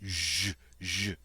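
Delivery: zh, a buzzing sh like the S in measure; the French zh as in measure; zh sound. Shown as a demonstration. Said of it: "a J sound in French is zh".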